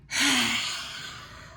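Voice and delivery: forceful open-mouthed exhale with the tongue out; lion's breath. Shown as a demonstration. Teacher showing the class a lion's breath, forceful sighing exhale. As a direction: huff the breath out from the back of the throat. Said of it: "a lion's breath, sticking the tongue out".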